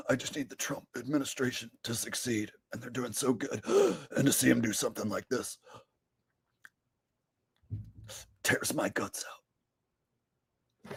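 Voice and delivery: soft, low, gasping mock Alex Jones; gasping Alex Jones impression. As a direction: gasp and whisper it like you're about to vomit. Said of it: "my new Alex Jones impression".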